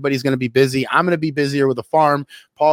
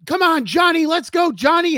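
high-pitched mock cheering; imitated yelling; 14 semitones higher than usual. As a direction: egg the wrestler on in a high voice. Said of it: "No, he, he yelled".

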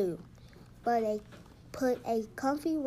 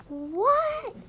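high, loud cry of disbelief; astonished cry; 12 semitones higher, 8 dB louder than usual. A narrator's reading. Granny hears Patrick has no pillow, astonished cry. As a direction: shoot the voice up in shock. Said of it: "cried Granny".

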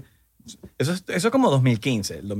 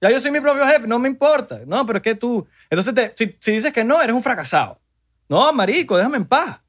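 exasperated rant; 7 semitones higher than usual. Acted. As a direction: vent at pushy sellers, exasperated. Ranting at sales pitches.

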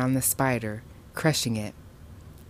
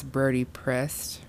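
ordinary delivery

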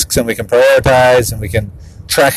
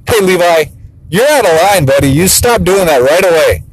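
raised-pitch mock-stern father; obligatory dad voice; 5 semitones higher than usual. Dad calling his son out of line, mock-stern scolding. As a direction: lay down the law, half-heartedly. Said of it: "just having to do it like it's obligatory".

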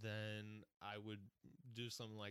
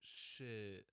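slow, lazy stoner drawl; laid-back stoner voice; 3 semitones lower than usual. Acted. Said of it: "He's using a stoner voice".

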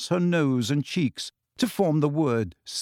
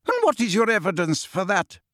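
a brisk, stern cut-in; sharp McGonagall; 7 semitones higher than usual. A narrator's reading. McGonagall interrupting Umbridge, brisk and stern. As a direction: challenge her crisply. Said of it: "cut in Professor McGonagall".

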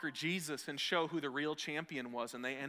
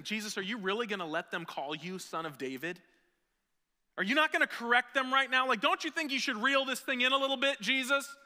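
indignant leaders; 8 semitones higher, 7 dB louder than usual. Retold or imitated. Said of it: "The former M V Ps are saying".

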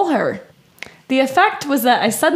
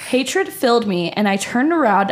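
ordinary delivery

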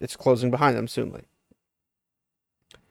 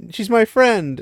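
high-pitched imitated begging; mock pleading; 9 semitones higher, much slower than usual. Egwene begging for her friend, high mock plea. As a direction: beg in a high put-on voice. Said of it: "it's like".

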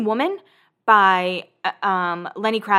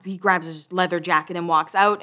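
ordinary talk, nothing marked

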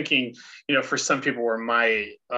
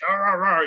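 mock grumpy growls, louder and higher; 6 semitones higher, 6 dB louder than usual. Grumpy mood, playful growling noises. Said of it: "when I'm in the grumpus mood".

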